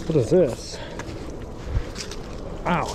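ordinary talk

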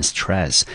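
"stress" drawn out slowly and clearly for students; teacher models "stress" slowly; slow model word. Shown as a demonstration. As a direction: slowly spell out the sound of "stress" as one clean syllable. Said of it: "我们念成stress".

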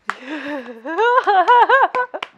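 high, rising cackle; big laugh. Real, not acted. Viewer amused by Hinata's scene, bursting into laughter.

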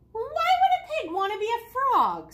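high, loud parrot voice; put-on parrot's questioning; parrot character. A narrator's reading. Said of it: "said the parrot".

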